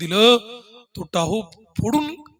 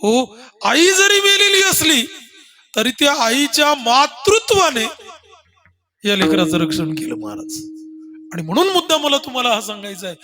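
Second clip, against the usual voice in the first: preaching at full force; 7 semitones higher, 7 dB louder than usual. Acted. Preacher drives home mother's love.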